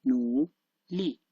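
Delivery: nuli slowly, as separate nu and li; syllable by syllable. Shown as a demonstration. Teacher demonstrating nuli individually, slow separated syllables. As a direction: stretch nu, pause, then li on its own tone. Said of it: "when I pronounce it like individual".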